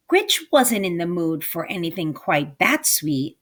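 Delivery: ordinary delivery, nothing marked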